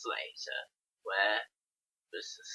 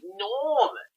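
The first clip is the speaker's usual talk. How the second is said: ordinary talk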